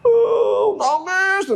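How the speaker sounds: high-pitched hammed-up wail; mock weeping. Prophet Nuh meets a weeping mother, mock wailing. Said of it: "ada ibu-ibu nangis".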